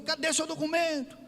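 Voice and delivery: high-pitched, mock-stern challenge; confrontational demand. Retelling how he confronted the driver, high-pitched demand. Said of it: "eu fui lá intimar o rapaz".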